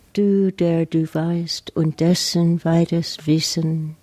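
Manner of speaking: put-on slow German line in an American accent; amateur German accent. Poet quoting Rilke for her listeners, slow non-native German accent. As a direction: recite the German slowly, as a non-native speaker. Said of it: "put up with my terrible German accent".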